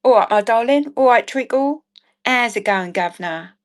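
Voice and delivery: playful put-on Cockney greeting; Cockney accent. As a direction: greet them like a Cockney charmer. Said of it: "do love a Cockney accent".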